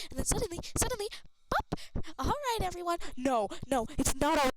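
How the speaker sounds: loud, high frantic yelling; loud outburst. Acted. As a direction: blurt it out wildly.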